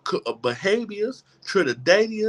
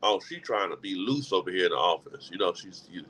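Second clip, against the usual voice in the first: much lower, quieter catty gossip; mocking office gossip; 12 semitones lower, 5 dB quieter than usual. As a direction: sneer like judgmental coworkers. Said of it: "pencil skirt".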